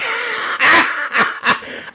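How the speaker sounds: high squealing laugh; a high, shrieking laugh. Real, not acted. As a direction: squeal with laughter at the rude comment. Laughs at rude comment.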